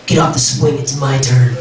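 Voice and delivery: put-on low, growly kid demanding a turn; scary six-year-old. Scary six-year-old claiming the swing, low menacing voice. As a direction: play a scary child in a deep voice. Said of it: "was I a really scary six-year-old".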